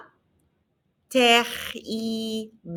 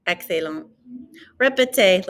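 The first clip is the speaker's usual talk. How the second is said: ordinary talk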